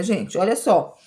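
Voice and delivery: ordinary delivery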